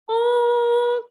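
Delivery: high, loud honk; playful tractor honk. Pretend. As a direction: blast it like a horn.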